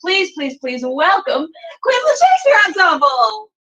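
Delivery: ordinary talk, nothing marked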